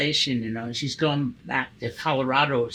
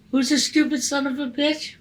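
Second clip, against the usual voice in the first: mock defiance, much higher; retold angry challenge; 10 semitones higher than usual. Facing the drunk passenger, a mock defiant comeback. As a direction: throw the insult back hard. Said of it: "And I go".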